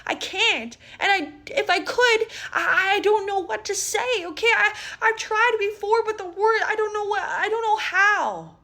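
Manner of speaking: high, halting upset voice; a scared kid's high stammering plea; distressed friend. Retold or imitated. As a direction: stumble over the words, close to tears. She goes.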